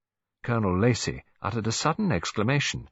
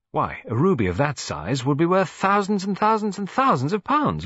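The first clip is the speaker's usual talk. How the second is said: put-on high, louder eager boy; excited boy; 10 semitones higher, 4 dB louder than usual. A narrator's reading. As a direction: jongen die opgewonden rekent. Michael lecturing Bridget.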